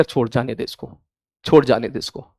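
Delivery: soft, low 'let it go'; retold restraint. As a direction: play down the matter, quiet and low. Retells calming students who report troublemakers.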